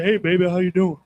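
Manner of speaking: low smooth-talking big man; big deep-voiced dude. Big dude greeting someone, deep low voice. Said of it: "big dude with a deep voice".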